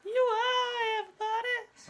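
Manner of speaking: high, slow crying shout; old radio show. Retold or imitated. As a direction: cry it out, slow and loud, radio melodrama. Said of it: "That's the line".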